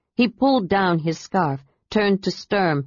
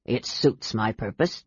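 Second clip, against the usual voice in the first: low, quieter dwarf grumble; grumpy dwarf; 7 semitones lower, 6 dB quieter than usual. A narrator's reading. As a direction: answer stubbornly, low and soft. Teased dwarf answers defensively.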